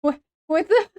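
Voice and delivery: loud, very high-pitched squeal; baffled shock. Real, not acted. Streamer reacting to bewildering content, baffled shock. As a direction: squeal it in real disbelief, breaking into laughter.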